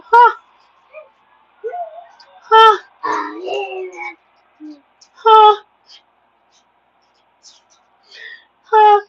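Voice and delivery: mocking devil laugh. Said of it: "the devil's literally laughing at these people".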